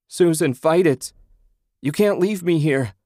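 loud, high, frantic calling; husband yelling to his fading wife; desperate yell. A narrator's reading. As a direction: scream at her to hold on. Husband begging vanishing wife.

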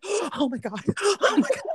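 gasped panic, rising in pitch; mock hyperventilation with laughter; hyperventilating. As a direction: gasp it as if you can't get air.

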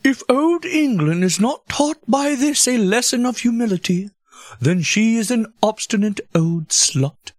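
high, slow olde English orator; Shakespearean voice. Acted. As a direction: declaim it like a Shakespeare actor. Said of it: "do your Shakespearean voice for this".

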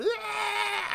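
high-pitched mock wail; long, high "aah" of mock agony; imitated scream. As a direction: imitate a horror-movie victim's drawn-out scream. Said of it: "bleeding out of her pussy going".

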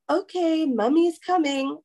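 mommy voice. Retold or imitated. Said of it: "And I go".

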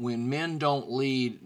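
ordinary talk

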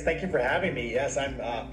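normal talking voice

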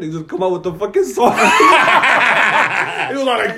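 cracking up mid-sentence, loud and high; telling the sword punchline, laughing hard. Real, not acted. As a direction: laugh through the words.

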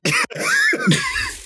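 high squealing laugh; squeaky giggle of embarrassment. Real, not acted. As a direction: burst into a high-pitched laugh. Laughing at own admission.

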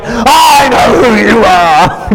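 slow, loud outburst; shout. Retold or imitated. Heckler shouting at Rabbi Jesus mid-sermon, slow loud yell.

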